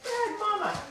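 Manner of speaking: high, soft playful self-reproach; gentle scold of a silly owner; soft mock scold. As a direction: murmur it at yourself in fun. Self-scolding while playing with puppy.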